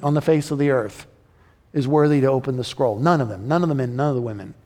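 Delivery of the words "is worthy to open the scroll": ordinary talk, nothing marked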